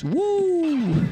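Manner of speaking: high, held whoop; mock yell. Brigand yelling in the orc's face, high whoop. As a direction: whoop like you're joining the orcs. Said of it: "between me yelling".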